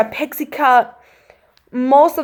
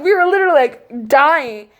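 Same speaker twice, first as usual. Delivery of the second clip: ordinary delivery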